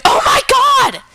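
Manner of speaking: acted horrified shriek, high and loud; freaking out. Dog catcher finding a hand inside the dog, freaking out. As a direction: cry out in mock horror, breaking off mid-sentence. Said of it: "starts freaking out too".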